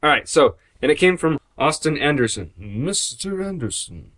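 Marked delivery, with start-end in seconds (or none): 2.6-4.1 slow, soft menacing agent; Matrix villain impression. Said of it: "The Matrix".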